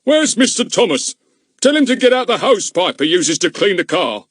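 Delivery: loud, high call across the fire; yelling. A narrator's reading. Policeman yelling for the chauffeur, loud. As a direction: yell for the chauffeur over the noise. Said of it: "yelled for the chauffeur".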